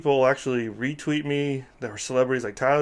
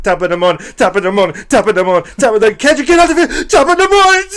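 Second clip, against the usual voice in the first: climbing higher and louder with each top of the morning; rising hammed-up chant ending in a screech; building mock frenzy; 9 semitones higher, 10 dB louder, faster than usual. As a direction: build to a frantic squeal. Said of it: "He's like".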